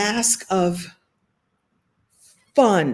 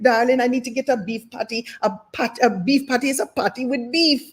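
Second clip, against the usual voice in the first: put-on comic Jamaican accent, lifted in pitch; 4 semitones higher than usual. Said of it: "I would do the Jamaican accent".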